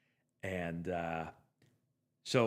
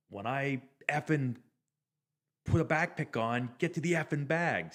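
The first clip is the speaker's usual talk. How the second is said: a mild mock-angry rant, pitch raised; retold angry rant; 5 semitones higher, 3 dB louder than usual. Catcher scolding his second baseman in the dugout, mild mock anger. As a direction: lightly replay the coach-like chewing out. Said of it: "he was pissed".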